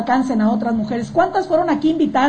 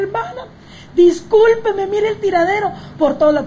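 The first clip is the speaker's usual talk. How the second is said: a higher, hurried flustered apology; 6 semitones higher than usual. Retold or imitated. Friend apologizing to the visiting sister, higher and flustered.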